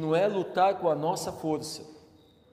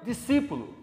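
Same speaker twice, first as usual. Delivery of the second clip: higher, louder impassioned sermon; fervent preaching; 6 semitones higher, 5 dB louder than usual. Acted. Pastor urges the congregation in the storm, fervent. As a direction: preach it with full fire.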